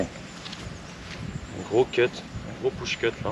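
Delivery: hushed whisper at address. Real, not acted. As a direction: whisper it under your breath. Whispering over the golf shot.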